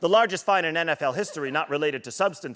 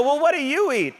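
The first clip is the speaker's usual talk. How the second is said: high and louder, baffled; incredulous question; 10 semitones higher, 5 dB louder than usual. Retold or imitated. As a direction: voice jumping up in disbelief. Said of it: "And I go".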